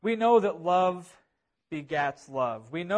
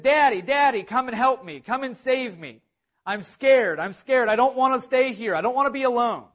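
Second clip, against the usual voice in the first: higher, louder cry for Daddy; scared child yelling; 6 semitones higher, 6 dB louder than usual. Retold or imitated. Frightened boy calls to his dad during a thunderstorm, scared yelling. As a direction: plead urgently like a frightened boy calling out. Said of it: "he yelled out to his dad".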